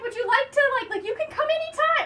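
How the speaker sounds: high, eager mock vicar voice. Vicar inviting her over, hammed-up high and eager. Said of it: "he keeps going, like".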